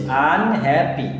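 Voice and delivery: Unhappy slowly, its three syllables set apart. Shown as a demonstration. Teacher corrects a pupil's syllable count, unhappy slowly divided. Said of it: "ভেঙে ভেঙে যাচ্ছে".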